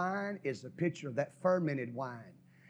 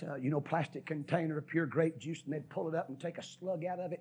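normal talking voice

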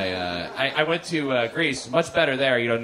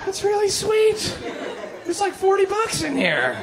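much higher, slow, pleased falsetto coo; delighted at work. Retold or imitated. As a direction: coo over the envelope in a high, slow falsetto. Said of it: "You're like".

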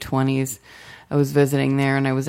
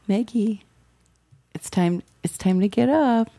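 higher, gentle sing-out with pauses; imitated mother waking her; 6 semitones higher than usual. Mother at the bedroom door, imitated higher wake-up call. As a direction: lightly mimic a mother coaxing a sleeper awake. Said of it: "knocks on the door when I'm sleeping".

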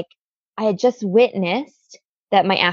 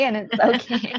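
1 laughing; 4 semitones higher than usual. Real, not acted.

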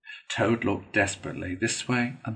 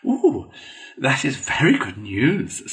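high opening, then measured approval; mildly solemn approval; grave Mole; 5 semitones higher, 5 dB louder, slower than usual. A narrator's reading. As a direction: take the news in earnest. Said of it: "said the mole gravely".

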